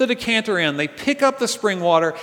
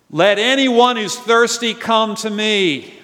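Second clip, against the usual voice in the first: slow, louder cry, pitched up; Jesus crying out; 3 semitones higher, 4 dB louder, slower than usual. Acted. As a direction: call it out slowly to the crowd. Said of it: "he cries out".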